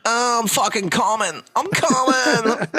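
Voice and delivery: hammed-up high moaning; mock orgasm moan. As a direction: moan it into the phone. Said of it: "you're just like".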